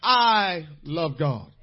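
loud, slow preacher's cry pressing each phrase; full-force preaching. Acted. Pastor preaching obedience from love to the congregation, full-force. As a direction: drive it home to the pews.

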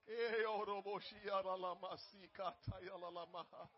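soft, low murmured tongues; speaking in tongues. Real, not acted. Pastor praying in tongues, hushed. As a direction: let the words flow unknown and quiet.

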